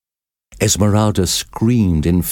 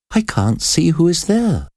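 Esmeralda voice; 10 semitones higher than usual. A narrator's reading.

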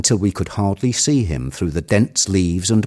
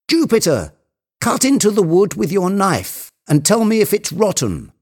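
high-pitched urgent command; ordering; 11 semitones higher than usual. A narrator's reading. Legrand ordering Jupiter to test the branch, high and commanding. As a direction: call instructions up to the climber. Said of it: "Legrand ordered".

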